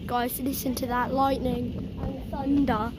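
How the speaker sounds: hushed, listening to the storm; breathy gasp then soft awe. Real, not acted. Hushed after big strike.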